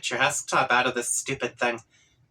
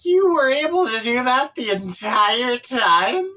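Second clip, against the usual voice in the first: loud, high disbelief then drawn-out slow curse; an incredulous cry; shocked outburst; 8 semitones higher, 5 dB louder, slower than usual. Acted. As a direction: yell it in stunned disbelief. Reader shocked at own character.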